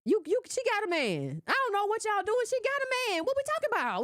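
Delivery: she's already got a man, what are you doing; exasperated disbelief. Real, not acted. Host scolding voters, high incredulous exasperation.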